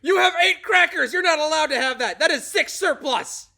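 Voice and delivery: high, loud barking drill sergeant; shouted mock drill sergeant rant; mock drill sergeant yell. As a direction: scream it like a drill sergeant over crackers. S1 acts out drill sergeants screaming.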